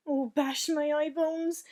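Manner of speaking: loud, slow giant lament; loud anguished exclamation; distressed cry. Acted. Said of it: "he cried".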